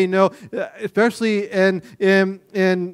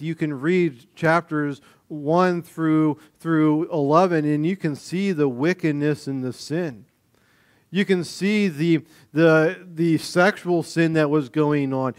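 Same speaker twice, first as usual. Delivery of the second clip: usual speaking voice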